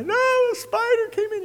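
put-on high squeaky little boy voice; child's voice. Preacher voicing his little son denying the bite, high childish voice. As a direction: squeak out a kid's innocent lie.

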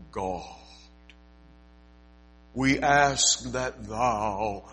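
slow, solemn King James prayer tone; mock a pompous churchy prayer. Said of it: "the tone of their voice".

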